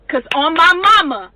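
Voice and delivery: ordinary talk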